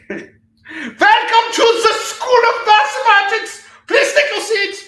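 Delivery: shrill, loud, slow schoolmistress greeting; mock strict teacher. Parodying the math school teachers for viewers, high and loud. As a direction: announce it like a stern headmistress, laughing through.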